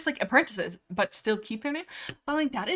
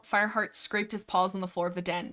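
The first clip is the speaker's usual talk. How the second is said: ordinary talk, nothing marked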